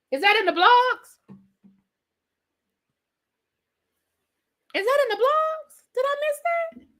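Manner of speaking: very high, incredulous questions; astonished at having missed the gossip; surprised. Real, not acted. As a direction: squeal the question in disbelief. Hears gossip she had missed.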